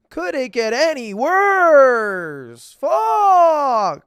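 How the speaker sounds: mock outrage. Streamer jokingly ranks a VTuber firing above the invasion, hammed-up yell. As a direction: play the last straw for laughs, stretch it, then explode.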